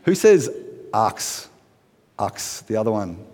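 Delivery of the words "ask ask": "arks" with the k before the s; mispronounced ask. Shown as a demonstration. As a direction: swap the end of ask into "arks". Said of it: "Can't quite say ask".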